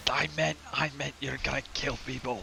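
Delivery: high, hushed clarification; protesting character voice. Acted. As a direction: explain yourself softly in a high character voice. Character clarifies after innuendo.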